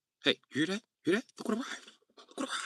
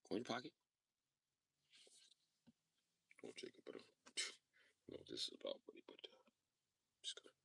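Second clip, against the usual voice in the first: very soft unvoiced nervous whisper; scared whisper; 20 dB quieter, much faster than usual. Debtor stalling Jacob in a frightened whisper.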